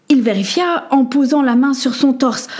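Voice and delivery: ordinary delivery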